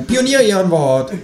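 high, cheeky kangaroo. A narrator's reading. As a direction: pitch way up for the kangaroo. Kangaroo character voice.

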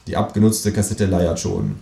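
plain reading voice

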